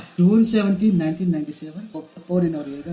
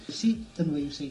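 normal talking voice

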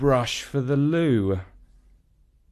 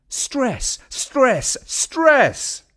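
each "stress" rising higher and louder; building stress; 13 semitones higher, 4 dB louder, much slower than usual. A narrator's reading. Narrator hammering the rising stress, building louder. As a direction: pile on the repeated word, growing.

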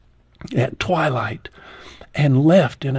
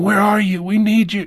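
higher, louder urgent calling; retold call from the ground; 8 semitones higher, 5 dB louder than usual. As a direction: shout up to the helicopter, pleading. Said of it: "calling to me in the air saying".

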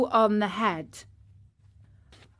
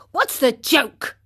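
higher, indignant question; angry; 5 semitones higher, 3 dB louder than usual. A narrator's reading. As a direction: demand an answer, mildly angry. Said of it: "he said angrily to them".

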